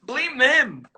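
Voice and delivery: high, slow, whiny excuse; pleading excuse. Retold or imitated. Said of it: "I was like".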